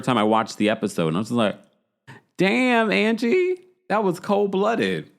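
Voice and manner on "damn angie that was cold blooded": high, drawn-out disbelief; shocked reaction. Retold or imitated. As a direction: react to savage shade, pitched right up. Reacting to Angie's shade.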